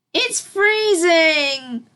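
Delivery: high, loud, drawn-out cry; a filly's slow high cry of shock. Acted. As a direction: wail it from the cold water. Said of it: "cried the filly".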